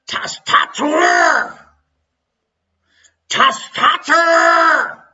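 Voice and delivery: slow, high, loud German shout; hammed-up shout. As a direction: bellow the word dramatically. Shouting foreign word dramatically.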